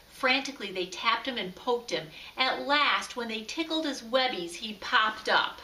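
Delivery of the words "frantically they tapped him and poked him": ordinary delivery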